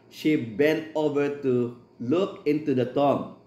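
slow, measured Bible reading; scripture reading. Acted. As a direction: read the verse slowly and solemnly. Reads scripture aloud in English.

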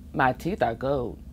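ordinary delivery, nothing marked

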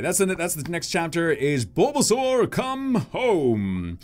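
normal talking voice

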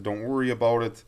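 ordinary delivery

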